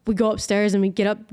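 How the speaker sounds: normal talking voice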